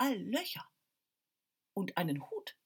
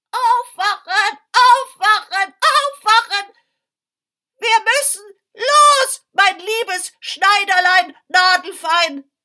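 very high, loud croaking wake-up call; croaking scarecrow shout; 13 semitones higher, 20 dB louder than usual. Acted. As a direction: screech them awake like a scarecrow. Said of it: "krächzte die Vogelscheuche".

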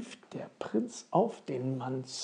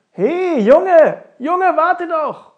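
loud, high holler after someone; high, full-voiced call from behind; 13 semitones higher, 15 dB louder, slower than usual. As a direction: shout to make someone stop. Stranger calls after the prince.

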